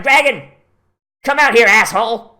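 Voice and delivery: a loud, high call; mock challenge shout. Saint George yelling for the dragon to come out, a mock challenge shout. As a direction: bellow out a challenge.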